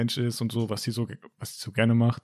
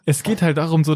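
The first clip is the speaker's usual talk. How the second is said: ordinary talk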